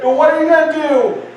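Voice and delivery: high, forceful jeering dare; defiant challenge hurled out; mocking Goliath. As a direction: taunt a whole cowering army. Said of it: "he mocked them and their God".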